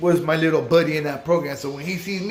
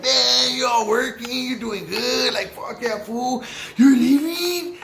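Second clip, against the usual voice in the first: higher, drawn-out excited greeting; excited homie; 6 semitones higher, slower than usual. Retold or imitated. Homie from the streets greets his friend, excited and drawn out. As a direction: imitate a friend getting carried away. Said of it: "we were excited".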